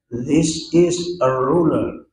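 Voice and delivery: put-on slow, careful English-accent This is a ruler. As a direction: recite This is a ruler like an English teacher. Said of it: "kayak orang Inggris". (logat Inggris yang pelan dan dibuat-buat)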